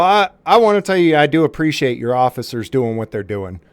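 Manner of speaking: ordinary talk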